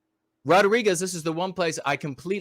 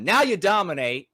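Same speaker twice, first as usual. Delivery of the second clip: usual speaking voice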